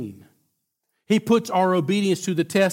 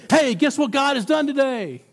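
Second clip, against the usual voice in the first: bursting, much higher and louder call; excited; 10 semitones higher, 4 dB louder than usual. Acted. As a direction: burst in the door with the good news. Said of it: "He's excited".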